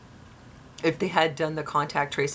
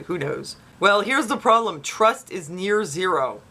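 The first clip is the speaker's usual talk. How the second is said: usual speaking voice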